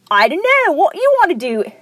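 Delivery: high-pitched mock [name] accent. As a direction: play a cartoon vulture with a [name] accent. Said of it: "like, a [name] accent".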